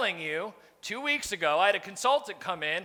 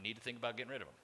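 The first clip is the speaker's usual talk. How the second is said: softer, quick, throwaway tone; offhand verdict; 10 semitones lower, 13 dB quieter, faster than usual. Retold or imitated. Consultant casually telling the boss to fire the sales manager, soft and quick. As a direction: toss off the bad news casually and quietly. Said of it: "he was like".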